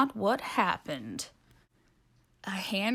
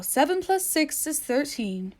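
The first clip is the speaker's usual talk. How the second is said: high, calm owl; 7 semitones higher than usual. Acted. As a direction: correct it matter-of-factly. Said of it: "said the owl".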